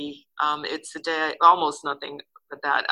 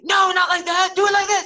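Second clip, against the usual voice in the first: high, loud imitation of a yelling director; director's yell; 13 semitones higher, 8 dB louder than usual. Director correcting a first-time actor on set, yelled. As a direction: bark the correction as the impatient director. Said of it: "he was yelling at me a lot too".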